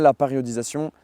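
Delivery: usual speaking voice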